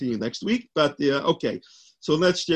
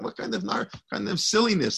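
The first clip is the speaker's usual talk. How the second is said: higher-pitched mock disbelief; incredulous scoffing; 6 semitones higher than usual. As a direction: retell the students' scornful protest, voice lifted. Students scoff at rabbi's advice.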